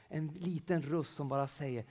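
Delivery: ordinary talk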